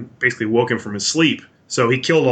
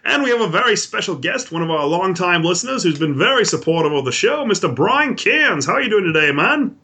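high-pitched mock Aussie; chirpy put-on Australian; Australian accent; 7 semitones higher than usual. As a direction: put on an Australian voice, well above your own. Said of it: "not doing the Australian accent".

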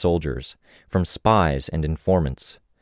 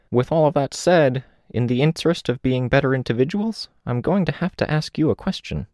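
put-on gently raised older woman's voice; a lightly voiced reflective old woman; 8 semitones higher than usual. Old woman speaking in story.

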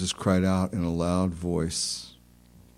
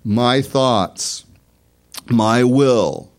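loud, raised, slow declaration in prayer; fervent prayer; 5 semitones higher, 6 dB louder, slower than usual. Real, not acted. Preacher prays for the congregation before communion, fervent and loud. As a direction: proclaim it to God, phrase by phrase.

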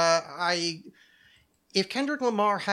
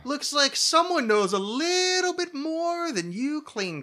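mock suspicion; 9 semitones higher, 3 dB louder, slower than usual. Teasing friend, mock suspicion.

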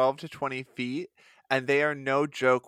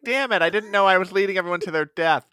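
higher, louder, fast regretful voice; higher quick guilty fish voice; Fish Maud voice; 5 semitones higher, 7 dB louder, faster than usual. Retold or imitated. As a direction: blurt it out as a doomed leader fish.

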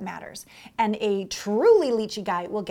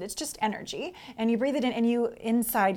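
ordinary talk, nothing marked

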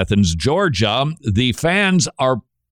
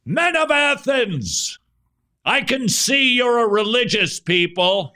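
big-voiced preacher; 11 semitones higher than usual. Host imitates a street preacher addressing stadium crowds, big voice. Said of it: "this man with the big voice stands up and says".

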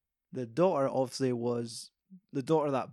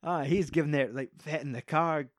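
ordinary talk

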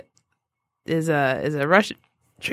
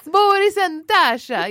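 slow, higher cartoon-spy Russian; cartoon Russian accent; 7 semitones higher, slower than usual. Recalling Bullwinkle villains, cartoon Russian accent. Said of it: "Rocky and Bullwinkle".

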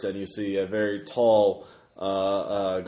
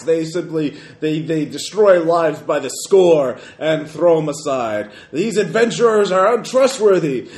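booming, higher, slow ranting roar; booming arrogant alien overlord; 8 semitones higher, 9 dB louder than usual. Acted. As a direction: rant at the crowd with contempt. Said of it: "that same booming voice".

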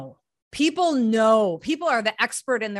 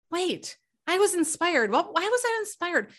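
puzzled realization; 7 semitones higher than usual. Retold or imitated. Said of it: "all of a sudden you realize".

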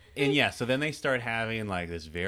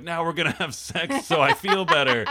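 mock smug man; 7 semitones higher, 7 dB louder than usual.

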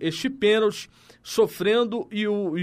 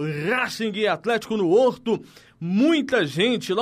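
ordinary talk, nothing marked

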